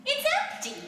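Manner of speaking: high astonished outburst; surprised cry. A narrator's reading. Orphan discovering the empty handle, high surprised cry. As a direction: blurt it out high and amazed.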